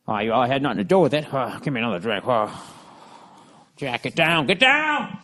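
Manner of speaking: a hint of a mumbling drunk talking in his sleep; sleep-talking Ted Kennedy. The wife hears her husband talk in his sleep, mumbled. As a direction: lightly voice him muttering through a bad dream. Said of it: "How much does that guy talk in his sleep".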